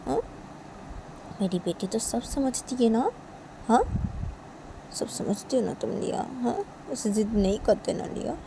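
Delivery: soft, affectionate coaxing; tender mother. Acted. Mother tells Liya she is a good daughter, soft and tender. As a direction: sweet-talk a child quietly.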